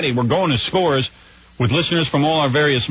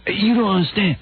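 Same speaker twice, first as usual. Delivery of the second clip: higher, put-on voice of a defensive host; Soupy explaining himself; 6 semitones higher than usual. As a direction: plead your case in a hammed-up impression. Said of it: "He would go".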